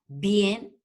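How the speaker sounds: "bien" on its own as a detached syllable; isolated syllable. Shown as a demonstration. Teacher counting the other syllable, "bien" said separately. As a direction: sound out "bien" alone, apart from the word. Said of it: "otra sílaba".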